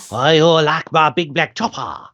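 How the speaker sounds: lightly put-on Japanese accent; English line in a mock Japanese accent; Jiro. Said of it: "Try saying some of Jiro's lines".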